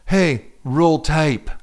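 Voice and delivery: a slow, lightly put-on British accent; bad British accent. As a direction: drawl it out in a weak British accent. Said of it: "so I'm gonna do it British".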